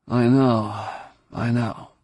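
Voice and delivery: groggy, half-awake mumbling; sleepy mutter. A narrator's reading. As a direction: mumble it like just woken. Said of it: "the sleepy voice muttered".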